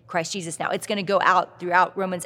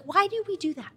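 put-on child's question; 11 semitones higher than usual. Son asks question.

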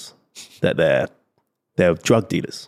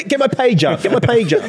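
high, laughing squeaky plug; laughing mock plug; 12 semitones higher than usual. Joking along with friends about TikTok fame, high laughing squeal.

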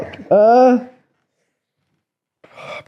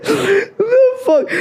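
high disbelieving "the fuck"; shocked squeal. Real, not acted. Shocked by a crude remark.

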